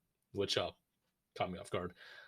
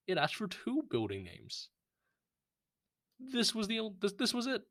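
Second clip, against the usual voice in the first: exasperated; 8 semitones higher than usual. Real, not acted. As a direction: voice climbing high in disbelief. Exasperated rant about wrong answer.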